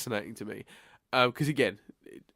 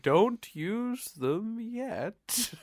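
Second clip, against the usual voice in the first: drawn out very slowly, higher pitched; slow speaking; 9 semitones higher, 4 dB quieter, much slower than usual. Retold or imitated. Mimicking the slow-talking character who is too late, high and very slow. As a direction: stretch every word in a high, slow imitation. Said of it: "it's the slow speaking of".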